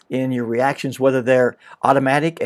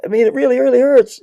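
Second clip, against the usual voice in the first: higher and louder, stretching the hurt; milk the pain, half-hearted whine; mock whiny insistence; 8 semitones higher, 6 dB louder than usual. Self-pincher insists to helper it hurts, whiny and louder. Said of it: "And you'd go".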